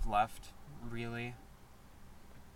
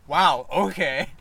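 slow, louder mock-offended; offended wow; 9 dB louder, much slower than usual. Friend retelling how the other reacted to the insult, slow and mock offended. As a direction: drag out the hurt surprise. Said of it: "and you said".

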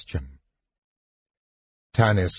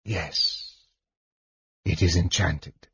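much softer, lower, satisfied hush; hushed whisper; 4 semitones lower, 7 dB quieter, slower than usual. A narrator's reading. Mage confirming the enchanted sack, in a soft hush. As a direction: breathe it with quiet satisfaction. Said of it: "he whispered".

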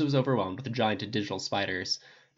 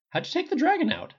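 amazed; 4 dB louder than usual. A narrator's reading. Tony amazed asks.

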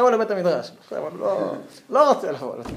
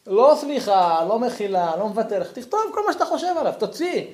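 ordinary talk

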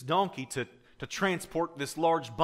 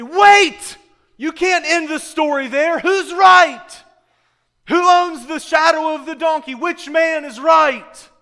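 much higher and louder, demanding an ending; crowd's eager outcry; 18 dB louder, slower than usual. Retold or imitated. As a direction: shout it out like a hooked audience. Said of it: "they say".

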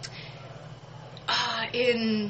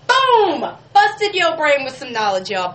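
loud, much higher triumphant "Boom!"; triumphant reveal; 7 semitones higher, 9 dB louder than usual. Real, not acted. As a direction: drop the fact like a mic drop. Trivia reveal on radio.

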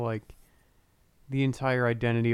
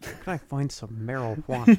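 lightly hammed-up narc voice; mocking a narc; 4 dB louder than usual. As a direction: ask like an undercover cop. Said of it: "mocking the awkward ask".

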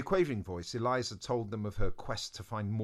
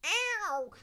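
very high tiny squeak; snail voice. Acted. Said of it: "that snail voice".

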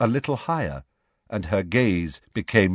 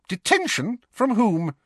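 McGonagall voice; 13 semitones higher than usual. A narrator's reading.

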